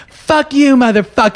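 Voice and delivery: high, loud mock yell at a cop; angry yelling. Drunk girl in cuffs screaming at the cop, high and loud. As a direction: shriek abuse at the officer, imitating her. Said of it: "just bitching out the cop".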